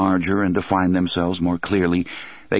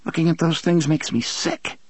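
trembling voice; 9 semitones higher than usual. A narrator's reading. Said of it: "in a trembling voice".

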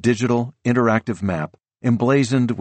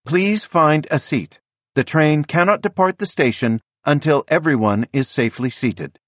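automated attendant voice; 8 semitones higher than usual. A narrator's reading. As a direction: speak like the train's automated voice. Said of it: "the automated attendant says".

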